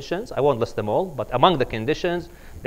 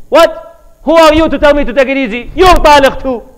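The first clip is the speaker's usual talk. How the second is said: loud, high, fast furious yell; angry outburst; 11 semitones higher, 12 dB louder than usual. Retold or imitated. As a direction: shout her down for telling him to calm. Husband snaps at second wife.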